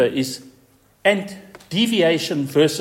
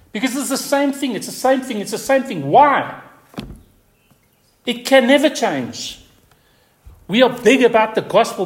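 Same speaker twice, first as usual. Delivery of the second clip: impassioned preaching; 7 semitones higher, 3 dB louder than usual. Real, not acted. Preacher rising to full force.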